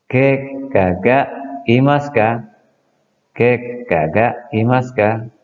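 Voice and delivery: Ke kaga imaska, slow with a lift on ke; slow model sentence. Shown as a demonstration. Teacher modelling the orthopedist question: slow and clear. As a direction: drill the question word by word. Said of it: "Kita masukkan".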